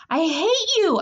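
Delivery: high-pitched angry outburst; furious scream. A narrator's reading. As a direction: scream it at your sister. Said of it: "I screamed to her".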